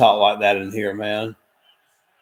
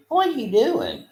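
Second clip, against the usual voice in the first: much higher, playful question to the pet; 13 semitones higher than usual. Real, not acted. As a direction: squeal it at the puppy. Talking to the dog.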